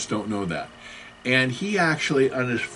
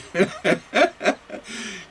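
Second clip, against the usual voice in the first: high burst of laughter; 8 semitones higher than usual. Real, not acted. Vlogger laughing at his joke about Americans, high laugh. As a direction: laugh at your own joke.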